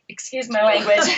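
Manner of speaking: ordinary delivery, nothing marked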